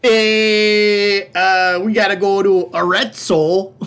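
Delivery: hammy Upper Midwest Wisconsin twang; Wisconsin accent. Pretend. Viewer request for Italian towns, hammed-up Wisconsin accent. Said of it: "with a Wisconsin accent".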